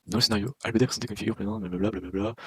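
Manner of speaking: rapid skim-read trailing into blablabla; skimming aloud. Real, not acted. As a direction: race through the text, dropping away on the blablas. Skims the text, skipping with blablabla.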